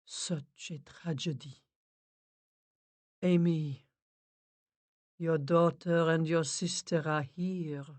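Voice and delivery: slow, soft, fading to a whisper; hushed medium. Acted. Medium relays the spirits, slow and hushed. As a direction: speak gently as a spirit medium. Medium speaks to the dead softly.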